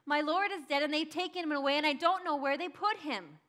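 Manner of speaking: grieving plea. Acted. Mary tells the angels her Lord is gone, grieving. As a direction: tell them through grief and urgency. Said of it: "I said to them".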